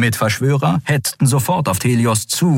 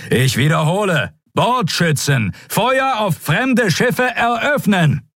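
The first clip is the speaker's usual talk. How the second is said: higher, slow, insistent shout; repeated command; 12 semitones higher, slower than usual. Acted. Admiral repeats his unanswered fire order, insistent shout. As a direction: repeat the order, harder now.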